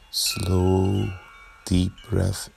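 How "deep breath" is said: usual speaking voice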